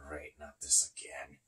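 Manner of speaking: very low, hushed aside; resigned near-whisper, barely voiced; muttered dread. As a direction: grumble it under your breath. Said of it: "just looking at her like".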